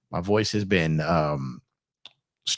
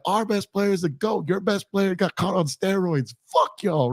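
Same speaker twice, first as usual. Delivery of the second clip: mock heckle; 9 semitones higher than usual. Heckling from the stands.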